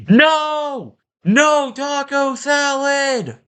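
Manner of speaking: high, shrill-pitched furious yell at the cashier; furious shriek. Retold or imitated. Angry customer screeches at the cashier, hammed-up high yell. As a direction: screech it like a boss monster. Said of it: "a boss battle was about to start".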